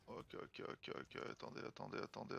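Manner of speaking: tense whispered ok, ok; whispering. Real, not acted. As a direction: whisper it under breath, tense.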